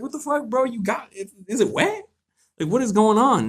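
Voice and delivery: high, startled yelp of disbelief; high-pitched shocked disbelief; shocked outburst. Retold or imitated. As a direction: squeak it out in stunned horror. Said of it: "you're just like".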